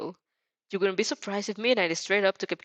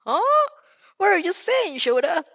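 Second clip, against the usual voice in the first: high, loud betrayed yelp; betrayed shout; 12 semitones higher, 7 dB louder than usual. Acted. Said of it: "who promptly shouts".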